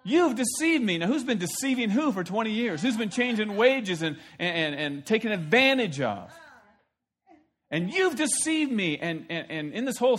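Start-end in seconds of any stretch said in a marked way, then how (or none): 0.0-1.0 higher, whiny you've deceived me; mock indignant. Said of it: "Isn't that just kinda funny". 7.7-9.0 higher, slow, whiny complaint; mock indignant. Mocking Laban again.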